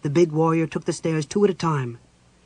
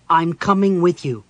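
loud, higher-pitched insistence; firm; 4 semitones higher, 9 dB louder than usual. A narrator's reading. Chrysania insists to Caramon that she's coming, firmly. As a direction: put your foot down, loudly. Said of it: "Chrysania said firmly".

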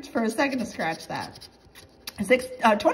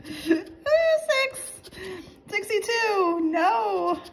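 very high-pitched wail; exasperated high-pitched despair; dismayed groaning; 9 semitones higher, 3 dB louder, much slower than usual. Real, not acted. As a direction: read each number as a high cry of dismay. Losing streak, dismayed reading.

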